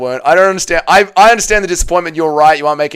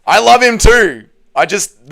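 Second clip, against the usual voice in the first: ordinary talk, nothing marked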